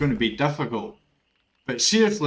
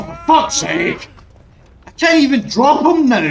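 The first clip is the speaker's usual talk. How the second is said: exasperated yell; 11 semitones higher, 10 dB louder than usual. Real, not acted. Streamer yelling at the game for not dropping him, exasperated yell. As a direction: let it burst out. Game won't drop survivor.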